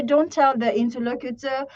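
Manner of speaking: ordinary talk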